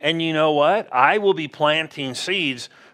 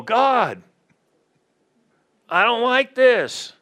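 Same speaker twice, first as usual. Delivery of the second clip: high, whiny complaint to God, half mocking; complaining to God; 8 semitones higher, 3 dB louder, slower than usual. Preacher mimics a believer whining to God: high mock complaint. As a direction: whine it up at God like a spoiled child. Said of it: "we can't go".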